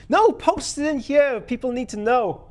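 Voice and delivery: loud and high, teasing; excited. Real, not acted. Streamer loudly urging chatter to post it publicly. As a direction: push him to share it, gleeful.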